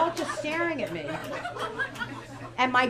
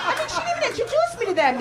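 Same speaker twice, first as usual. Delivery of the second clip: exasperated rant; 12 semitones higher, much faster than usual. Real, not acted. Ranting fast, high.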